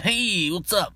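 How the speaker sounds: high, chummy sales pitch; pushy salesman. Retold or imitated. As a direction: sell it like an eager floor rep. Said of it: "their attitude".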